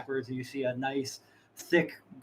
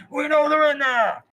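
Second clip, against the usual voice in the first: high, loud holler with hand over mouth; muffled echoing shout; 9 dB louder, slower than usual. Acted. Bandit yells from outside, muffled for a little echo. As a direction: yell through a covered mouth.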